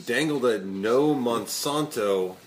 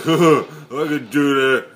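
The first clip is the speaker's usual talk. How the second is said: tough guy voice; 4 semitones higher, 11 dB louder, much slower than usual. Retold or imitated. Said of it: "John Rambo's like".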